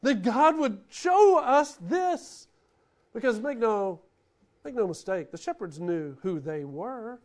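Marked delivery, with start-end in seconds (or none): 0.0-2.5 higher, slow and drawn out in wonder; a shepherd stunned by what God showed him; awed, slow I can't believe; amazed disbelief. Retold or imitated. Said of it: "That's what they were going".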